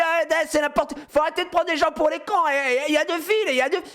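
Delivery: high-pitched outraged rant; mock angry yelling. Angry man in the queue yelling at neighbours, high mock rant. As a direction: shout it like you've been cheated. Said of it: "le mec qui gueule".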